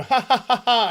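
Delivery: a hollow canned ha ha ha; mock forced laugh. Reviewer mocking the show's intended laugh, fake laughter. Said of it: "it's meant to make us go".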